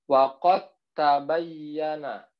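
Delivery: waqad tabayyana recited slowly with d merged into t; merged recitation. Shown as a demonstration. Teacher showing students the right reading, slow recitation. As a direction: stretch waqad tabayyana, no pause between d and t. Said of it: "Jadi bacanya".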